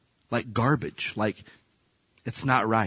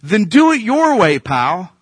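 higher and louder, a sharp offhand dismissal; dismissive God; 8 semitones higher, 7 dB louder, slower than usual. Acted. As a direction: toss it off with a cocky edge. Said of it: "God's like".

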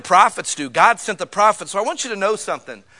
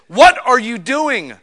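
louder, slow, scolding prophet; prophet preaching at people; 3 semitones higher, 7 dB louder, slower than usual. Retold or imitated. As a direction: call them out like an old prophet, slowly. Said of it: "start preaching at people".

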